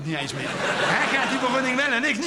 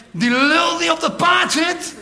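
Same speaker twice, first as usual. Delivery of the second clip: a retold heckle, hands cupped round the mouth; called out through cupped hands; 7 semitones higher, 5 dB louder than usual. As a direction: yell it across the square at the rider. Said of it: "handen aan mijn mond gezet".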